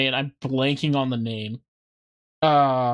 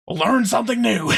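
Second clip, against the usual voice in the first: raised-pitch hammed-up delivery; hammed-up boast; 6 semitones higher than usual. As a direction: play it up, pitched higher. Plays hero boasting of replayed time.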